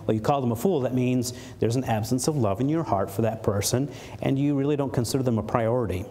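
ordinary talk

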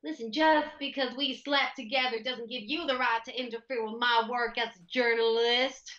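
broad American Southern drawl, drawn out at the end; real Southern accent. Shown as a demonstration. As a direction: lay on a thick Southern accent. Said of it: "I can go real Southern".

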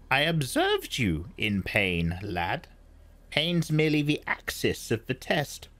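put-on high, measured old woman's voice; old Reverend Mother. A narrator's reading. Old woman explains the box to Paul, high and measured. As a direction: speak as the stern old crone.

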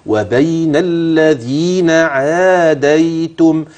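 الذين عاديتم slow with full natural madd; مد طبيعي واضح في الذين عاديتم; clear vowel lengths. Shown as a demonstration. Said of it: "خلي بالك من الممدود".

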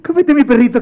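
high, insistent coaxing; pleading offer. Acted. Mateo pressing his dog on the monster, high pleading. Same offer repeated, higher.

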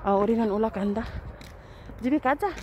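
usual speaking voice

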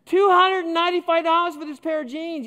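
outraged disbelief. Retold or imitated. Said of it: "I nearly fainted".

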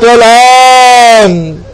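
one long wailed, held vowel; grieving cry. A narrator's reading. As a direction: cry out in lament and let it stretch. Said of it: "Hussein cries out".

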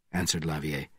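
plain reading voice

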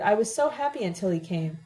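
ordinary delivery

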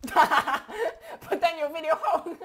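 laughing loudly. Real, not acted. Laughing at the recording friend.

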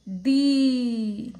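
दी loud, with a long ee; long-vowel demonstration. Shown as a demonstration. Teacher shows what it becomes, long loud दी. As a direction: stretch दी out loudly. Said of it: "तो क्या बनेगा?".

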